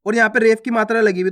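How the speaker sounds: normal talking voice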